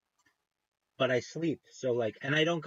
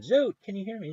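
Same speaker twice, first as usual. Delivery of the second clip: calling out a name, half-raised and higher; retold yelling; 7 semitones higher than usual. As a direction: shout across the line to someone who can't hear you. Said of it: "I kept yelling at him".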